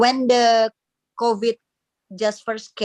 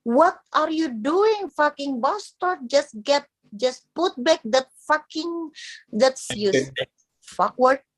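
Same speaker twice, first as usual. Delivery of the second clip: angry parent; 6 semitones higher, slower than usual. Retold or imitated. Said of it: "they will say".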